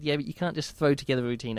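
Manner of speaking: usual speaking voice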